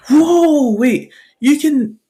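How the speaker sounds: high, drawn-out astonishment; amazed classmate. Acted. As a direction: stretch it out in awe.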